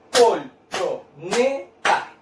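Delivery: "colchoneta" very slowly, col-cho-ne-ta; syllable by syllable. Shown as a demonstration. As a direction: clap out "colchoneta" as four separate syllables.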